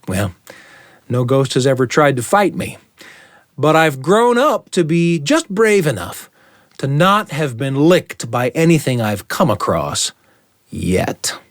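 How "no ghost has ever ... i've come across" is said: ordinary delivery, nothing marked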